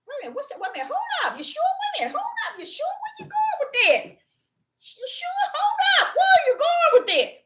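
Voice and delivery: very high, squeezed incredulous interruption; alarmed protest. Acted. Preacher stopping Yeshua mid-verse, alarmed protest.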